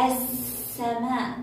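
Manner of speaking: "السماء" slow as as-samaa, no L; silent lam. Shown as a demonstration. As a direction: hold a long doubled س in السماء. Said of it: "هل لفظنا اللام؟".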